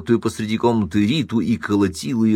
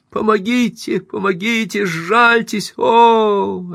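high-pitched pleading cries for help; weakening cries; 13 semitones higher, 4 dB louder, slower than usual. A narrator's reading. Beaten woman pleads for mercy, weakening cries. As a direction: beg for mercy, high and desperate. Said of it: "Это был слабеющий голос Риты".

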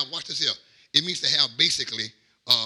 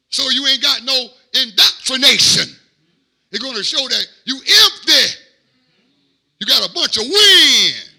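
rising, louder preacher's warning; building preaching; 8 semitones higher, 8 dB louder than usual. Acted. Preacher warning the church about empty talkers, building preaching. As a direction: build each line higher and harder.

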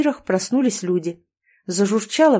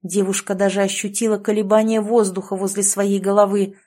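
usual speaking voice

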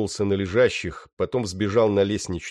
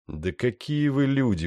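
surprised; 6 semitones higher than usual. Acted. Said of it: "удивился я".

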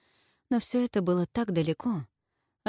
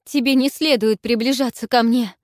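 pained loud cry, higher; moaned; 6 semitones higher, 9 dB louder than usual. A narrator's reading. Olga to Zhelan, anguished loud moan. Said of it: "простонала Ольга".

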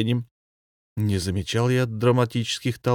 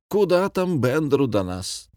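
higher, animated rejoicing; gleeful; 7 semitones higher than usual. A narrator's reading. Commandant rejoicing at billions within reach, gleeful. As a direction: crow over the riches to come. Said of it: "радовался Фокусов-Веселовский".

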